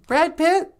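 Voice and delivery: very high, loud questioning voice; high-pitched cartoonish reply; squeaky sidekick. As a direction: pipe up as a tiny sidekick.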